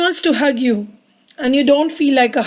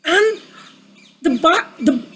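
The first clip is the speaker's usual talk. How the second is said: plain reading voice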